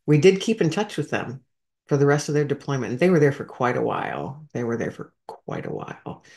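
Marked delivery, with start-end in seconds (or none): 4.5-6.2 whispered echo, much softer; softly whispered aside. Real, not acted. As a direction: drop to a breathy whisper on the repeat. Repeats the line, second time hushed.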